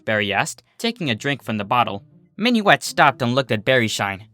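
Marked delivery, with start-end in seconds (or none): none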